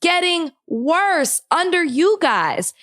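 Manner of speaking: real anger, words stretched out; exasperated outburst. Real, not acted. Podcaster rails at the administration, exasperated.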